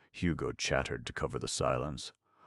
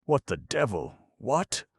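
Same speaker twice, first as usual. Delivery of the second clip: high, loud startled cry; shocked outburst; startled alarm; 11 semitones higher, 7 dB louder than usual. Acted. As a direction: jolt in shock. Alarm at empty canoe.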